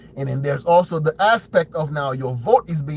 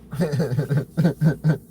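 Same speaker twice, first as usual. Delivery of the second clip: put-on chuckle in rapid bursts; mock chuckle as a president; imitated Trump laugh. Commentator imitating the president laughing at opponents, put-on chuckle.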